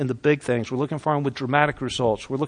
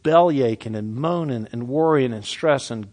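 ordinary talk

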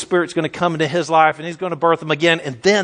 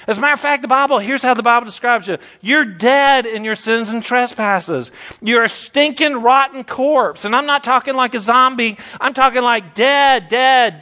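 full-force preaching; 3 semitones higher than usual. Acted. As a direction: thunder it at the congregation, slowing on the key words.

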